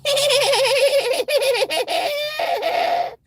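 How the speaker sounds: long, very high shrieking cackle; hammed-up laugh. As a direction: cackle high and draw it right out. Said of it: "I'm laughing".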